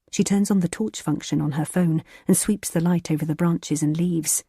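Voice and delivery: ordinary delivery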